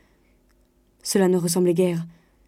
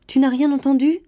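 high, louder question rising at the end; anxious question; 7 semitones higher, 6 dB louder than usual. A narrator's reading. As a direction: probe nervously, voice lifted. Panicked wife probes husband.

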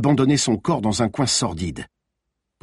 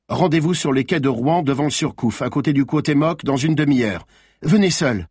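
higher, firm orders; dry commanding; 6 semitones higher, 4 dB louder than usual. Acted. Malines dictating the meeting place to the killer, dry and firm. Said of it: "d'une voix sèche et rapide".

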